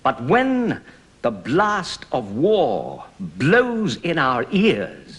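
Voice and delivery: "blows" weighted, slow and heavy through "the blast of war blows"; stress on blows. Shown as a demonstration. Actor showing the class the stress on "blows", slow and heavy. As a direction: lean into "blows" as the beat breaks. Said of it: "Blows is specially stressed there".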